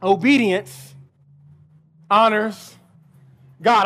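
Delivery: each word set apart by long pauses, low and deliberate; slow emphatic refrain. Real, not acted. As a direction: let every word land alone, low and slow. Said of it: "slow emphatic sermon refrain".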